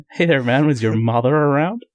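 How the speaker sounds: put-on American accent. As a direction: imitate an American talking. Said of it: "That was my American accent".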